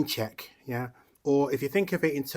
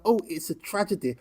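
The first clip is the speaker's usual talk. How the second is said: mocking politician; 7 semitones higher, 7 dB louder than usual. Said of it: "said Andy Burnham".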